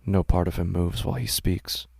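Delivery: ordinary delivery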